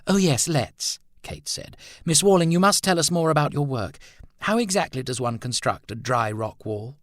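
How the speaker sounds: a hostess changing the subject gladly; eager hostess. Acted.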